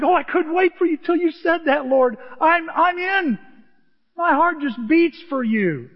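high, loud, overjoyed reply; eager excitement. Acted. David answering God's invitation: thrilled and high. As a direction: gush like you've waited all day for this.

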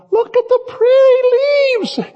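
put-on high, slow little boy voice; dreamy child voice. Grandpa imitating Owen staring at leaves, high slow child voice. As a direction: float it like a daydreaming kid. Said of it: "Owen's out there going".

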